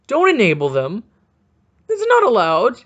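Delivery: loud, insistent scolding; loud, indignant objection; exasperated protest. Acted. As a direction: raise your voice and forbid it. Outburst against the trade continues.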